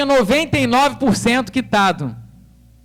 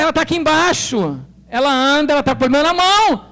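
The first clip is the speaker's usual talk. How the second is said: higher and louder insistent explanation; urgent protest; 6 semitones higher, 5 dB louder than usual. Retold or imitated. To the notary clerk demanding a certificate, urgent protest. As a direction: insist she is right downstairs.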